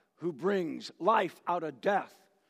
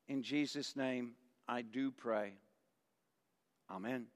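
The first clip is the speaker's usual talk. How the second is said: prayer; 2 semitones lower than usual. Acted. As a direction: pray reverently with the congregation, letting the amen drop away. Leads a prayer.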